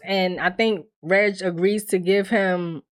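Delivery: ordinary talk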